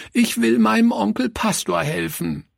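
put-on high, pleading girl's wail; acted weepy, high-pitched insistence; sobbing child's cry. A narrator's reading. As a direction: plead tearfully like a small child. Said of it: "rief sie schluchzend".

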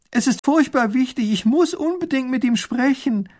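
high, urgent, loud plea; calling after someone. A narrator's reading. Woman shouts after the coach, urgently calling. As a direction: call out desperately at a high pitch. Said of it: "ruft hinter der Kutsche her".

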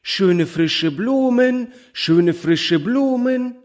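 high, slow singsong street call of a little flower seller; flower girl calling out. A narrator's reading. Little flower girl calling to the ladies, high slow singsong. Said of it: "indem sie rief".

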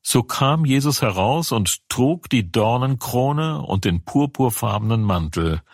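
ordinary delivery, nothing marked

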